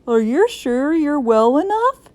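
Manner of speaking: lightly voiced principal checking up; a hint of a doubtful principal; school principal. A narrator's reading. Principal questioning in story.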